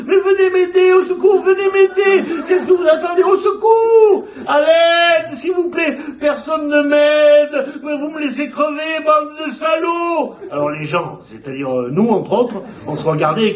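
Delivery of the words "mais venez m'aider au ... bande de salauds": very high, loud calls from a window; yelling for help. Retold or imitated. Woman at window yelling to neighbours for help, very high and loud. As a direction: shout to the neighbours, then curse them. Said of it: "elle criait aux gens".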